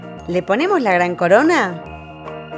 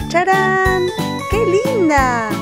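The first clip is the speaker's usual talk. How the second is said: excited reveal; 6 semitones higher, much slower than usual. Acted. Unboxing reveal.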